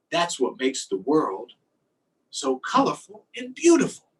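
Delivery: lively, sing-song picture-book reading; reading to children. Acted. Teacher reading a fish book to her class, lively storytime voice. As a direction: read it out like storytime for little kids.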